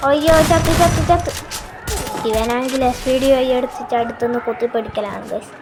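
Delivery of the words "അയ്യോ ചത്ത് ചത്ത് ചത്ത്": loud, high panicked yelp; panicked cry. Real, not acted. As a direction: shout in sudden game panic. Player getting killed mid-game.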